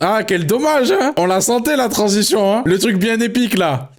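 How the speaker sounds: high, hyped-up mock build-up; hyped teasing. Real, not acted. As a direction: tease the chat like the big moment is coming. Teasing chat about missed transition.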